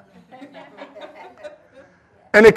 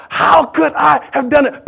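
high, slow, drawn-out disbelief at yourself; anguished self-reproach; 9 semitones higher, 4 dB louder than usual. Acted. Preacher voicing anyone who let themselves down, anguished self-reproach. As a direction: agonize over your own mistake. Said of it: "I mean, you're thinking".

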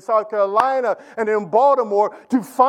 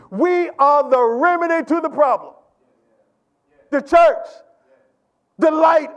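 full-force preaching; 5 semitones higher than usual. Acted. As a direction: preach it to the congregation with rising fire.

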